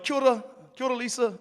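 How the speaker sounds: high, bright-eyed hello; cheery greeting. Retold or imitated. Twelve-year-old greeting the farmer's wife on his way to the door, high and cheery. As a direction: chirp it out like a keen kid.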